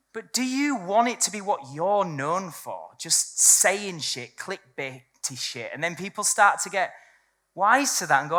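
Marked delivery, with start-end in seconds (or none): 3.0-5.7 slow emphasis. Real, not acted. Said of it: "slowed for emphasis".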